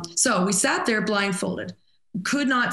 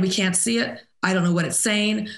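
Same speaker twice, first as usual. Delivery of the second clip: ordinary talk, nothing marked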